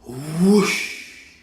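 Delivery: loud rising whoosh; whoosh sound. Shown as a demonstration. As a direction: swoosh the broom away. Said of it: "say it with me".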